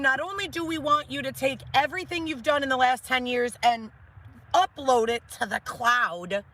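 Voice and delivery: high, pushy boss voice; quoting a boss. Retold or imitated. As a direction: rattle off the demands. Boss ultimatum to doctors, quoted.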